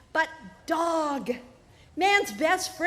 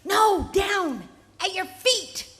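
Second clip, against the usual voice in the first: raised, higher call from below; 5 semitones higher, slower than usual. Acted. Snake calling up to the man, raised and higher. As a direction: shout it up from the ground.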